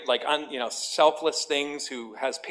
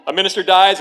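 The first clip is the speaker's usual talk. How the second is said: "A minister dies" relaunched higher and much louder; loud restart; 5 semitones higher, 11 dB louder, faster than usual. Real, not acted. Pastor restarting the joke for laughs, loud and high. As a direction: punch "A minister dies" out again, bigger. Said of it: "you didn't think I was gonna start there".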